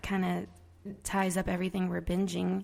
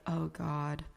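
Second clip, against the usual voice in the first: low, soft aside of dismay; retold dismay; 4 semitones lower, 7 dB quieter than usual. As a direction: groan it under your voice, lower and softer. Retold dismayed reaction to the accent.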